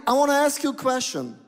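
usual speaking voice

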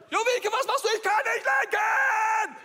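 high, slow, loud shout with a laugh in it; calling after a runaway sledder; alarmed shout. Retold or imitated. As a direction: yell after her, half scared, half laughing. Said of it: "Ich so".

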